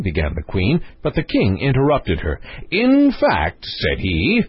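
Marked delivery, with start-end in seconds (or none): 2.7-3.6 high, emphatic pronouncement; triumphant conclusion. A narrator's reading. King interrupting the queen, triumphantly conclusive. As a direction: cut in as if settling the argument. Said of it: "with the tone of one who concludes an argument".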